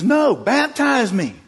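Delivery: high, loud, slow command; acted emphatic order; firm insistence. Acted Jesus insists to John.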